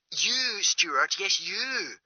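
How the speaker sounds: small, faraway voice down a phone line; Greer on the phone. Acted.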